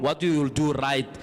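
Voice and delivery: ordinary talk, nothing marked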